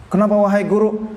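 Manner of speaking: high, startled question; a student startled to see his teacher weep; surprised student. Retold or imitated. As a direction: ask in alarm why he is weeping.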